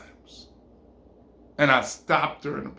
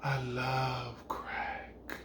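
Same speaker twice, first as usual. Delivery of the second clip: very slow, low and soft; hushed, savouring each word; blissed-out murmur; 4 semitones lower, 13 dB quieter, much slower than usual. Acted. Woman after smoking confides in him, slow and blissful. She stops crying, savours the smoke.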